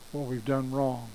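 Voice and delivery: normal talking voice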